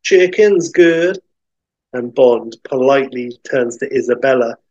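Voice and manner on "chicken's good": slow, higher Midwestern waitress drawl; loud, drawn-out Midwestern drawl; thick Midwestern drawl. Acted. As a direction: stretch it out in a broad Midwestern accent. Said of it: "in her thick Midwestern drawl".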